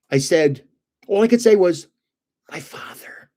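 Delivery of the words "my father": can't get the words out, whispering "My father"; choked whisper. Retold or imitated. Telling his boss his father died, choked whisper. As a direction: voice gone, "My father" barely breathed out. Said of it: "like that".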